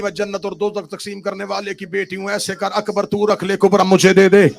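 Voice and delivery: plead, then cry out in song; rising pleading lament. Real, not acted. Pleading chant rising to shouts. (التجا سے بلند ماتمی صدا تک)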